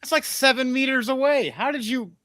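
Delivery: very high, disbelieving protest; high, baffled mock outrage; incredulous. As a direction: pitch it way up in mock astonishment. Acts out baffled players' reaction.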